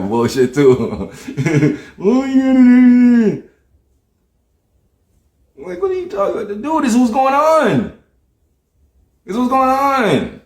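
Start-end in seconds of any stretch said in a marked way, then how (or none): 1.2-3.4 long high "oh you" wail; exaggerated falsetto whine imitating mom; mock mom squeal. Son imitating his mom's reaction, drawn-out mock squeal. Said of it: "mom be like". 5.6-10.4 high-pitched incredulous protest; mock exasperation. Arguing over what's going on, high mock exasperation.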